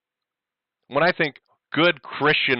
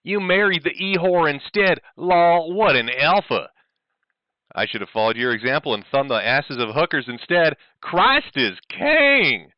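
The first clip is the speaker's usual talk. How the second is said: mock Southern voice; 4 semitones higher, 3 dB louder than usual. Reading Ethan's messages.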